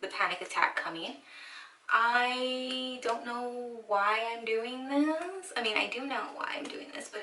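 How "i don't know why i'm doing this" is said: slow, drawn-out lament; dreading whine. Real, not acted. Dreading her own home haircut, a long slow whine. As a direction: draw the words out in rising dismay.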